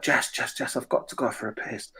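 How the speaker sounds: urgent stage whisper. Retold or imitated. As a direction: hiss it so the crowd can't hear. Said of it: "I'm just like".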